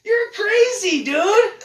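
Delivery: over-the-top delighted Satan, shrieking with laughter; gleeful devil. Retold or imitated. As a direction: ham up the devil greeting a new arrival with a cackling yell. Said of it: "Lucy's just like".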